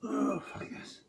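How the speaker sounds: high strained cry of relief; strained triumph. Real, not acted. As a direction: push it out as the set ends. Finishing a hard set.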